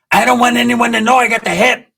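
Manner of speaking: high, loud mimicked protest; Pepe. Pepe keeping his condition private, high mimicking voice. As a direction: push the impression up high and strained.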